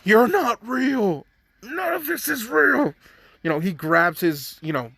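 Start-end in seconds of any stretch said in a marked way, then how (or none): 0.0-2.9 mock scream. Deku yells at the fake family, in a mock higher shout. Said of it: "Deku screams out at them".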